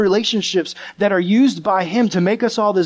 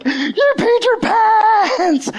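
very high screech, stretched out long; taunting kids' yell; 4 dB louder, much slower than usual. Retold or imitated. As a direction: imitate a class shrieking a taunt. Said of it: "start doing the same thing".

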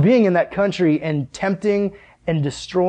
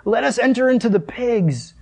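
higher, slow pleading demon voice; begging demons; 6 semitones higher than usual. Acted. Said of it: "begged him, saying".

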